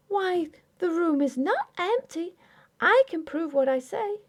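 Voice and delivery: put-on high, pleading young wife voice; young wife Grace. A narrator's reading. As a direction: voice Grace, hurt and insistent.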